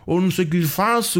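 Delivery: high-pitched imitation of a threatening scammer; acted exasperated demand, high and insistent; mock threat. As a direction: re-enact his desperate threat, pitched right up. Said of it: "começa-me a ameaçar".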